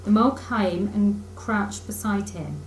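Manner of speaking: plain reading voice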